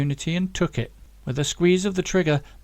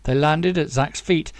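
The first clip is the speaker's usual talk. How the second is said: usual speaking voice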